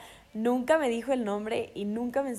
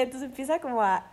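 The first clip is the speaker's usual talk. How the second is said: ordinary talk, nothing marked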